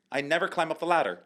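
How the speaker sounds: put-on American Valley Girl. Shown as a demonstration. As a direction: say it at normal speed in American. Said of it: "the way the girl would say it".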